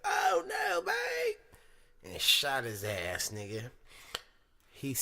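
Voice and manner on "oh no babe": high, shouted mock panic; hammed-up terrified plea; panicked husband. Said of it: "He was like".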